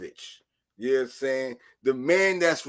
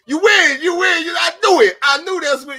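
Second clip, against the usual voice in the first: much higher and louder, hammed-up cheering; mock victory shout; 14 semitones higher, 8 dB louder than usual. Winning contestant celebrating a solved puzzle, shouting high. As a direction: scream it like you just won. Acting a game show win.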